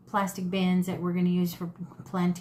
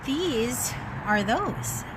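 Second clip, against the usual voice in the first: normal talking voice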